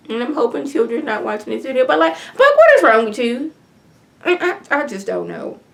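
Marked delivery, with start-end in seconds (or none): 2.4-3.5 loud, higher what is wrong with you; exasperated scold. Retold or imitated. Viewer yelling at Buck, loud and fed up. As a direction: call him out, fed up.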